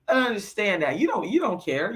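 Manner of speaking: normal talking voice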